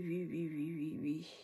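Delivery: usual speaking voice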